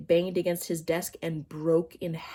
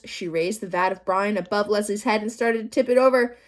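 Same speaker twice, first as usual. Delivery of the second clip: plain reading voice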